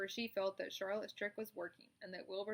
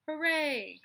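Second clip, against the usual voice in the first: loud, high cheer; 7 semitones higher, 11 dB louder than usual. Acted. The animals cheer Charlotte's plan, loud. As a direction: shout it in triumph. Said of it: "cried everybody".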